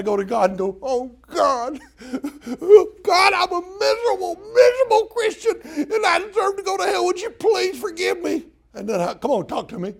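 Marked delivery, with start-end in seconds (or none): 0.8-8.5 much higher, wailing fake cry; mock groveling prayer. Preacher mocks groveling sinners, high hammed-up wailing. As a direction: grovel and wail like a miserable sinner. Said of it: "We think we gotta grovel".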